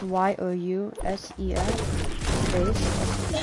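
spelled out slowly letter by letter; slow spelling. Real, not acted. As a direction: spell each letter carefully. Spelling out a channel name.

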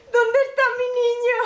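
high loud anguished wail; anguished cry. Acted. Mother crying out to the officer for her son. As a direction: cry out for your child.

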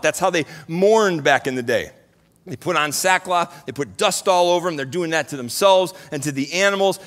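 normal talking voice

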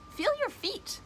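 very high, playful lilt; high-pitched and teasing, breaking into a laugh after; playful announcement. Real, not acted. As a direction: sing out the title in a high, silly voice. Playfully announces second technique's name.

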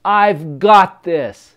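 slow, loud, emphatic affirmation; loud pep-talk mantra, each word weighty; confident with feeling. Shown as a demonstration. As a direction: declare it slowly with full conviction. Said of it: "with some feeling and with some confidence".

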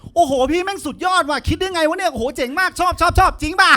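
a high, starstruck junior raving about the senior's trick; gushing fan. Retold or imitated. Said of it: "มึงเสียงมึงเบามาก".

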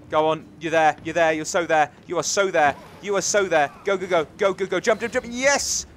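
excited urging. Real, not acted. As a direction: urge them on into a triumphant shout. Urging character on, then triumph.

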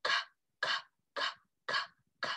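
"k" clicked like cutting, no vowel; evenly spaced voiceless "k"; hard C sound. Shown as a demonstration. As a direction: snip out each "k" separately. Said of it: "like when you're cutting".

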